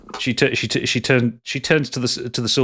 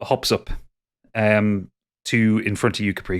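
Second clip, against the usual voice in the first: ordinary delivery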